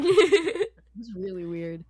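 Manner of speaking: laughing the words out, slow. Real, not acted. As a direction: giggle through the whole line. Recalls weird meeting, laughing.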